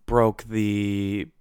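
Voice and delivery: usual speaking voice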